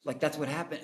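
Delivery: ordinary delivery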